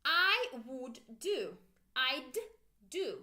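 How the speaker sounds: slow, careful "I would do" with would fully sounded; slow full form. Shown as a demonstration. Teacher modelling full form slowly. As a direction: spell out "I would do" slowly for students to copy. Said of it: "Make sure you pronounce with me".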